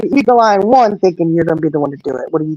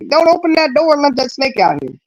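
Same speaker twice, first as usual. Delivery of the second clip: mom ordering; 8 semitones higher than usual. Retold or imitated. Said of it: "I was like".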